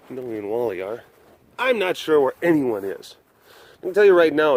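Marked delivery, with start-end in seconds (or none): none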